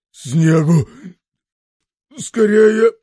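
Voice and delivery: desperate cry. A narrator's reading. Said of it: "бормочет".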